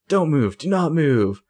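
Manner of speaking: mock police command. Cops cornering the group, mock police command. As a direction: order them still like an arresting cop, mock-stern.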